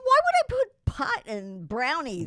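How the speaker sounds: high, loud and slow disbelief; incredulous. Retold or imitated. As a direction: spell out the disbelief, playing it up. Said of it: "I'm going".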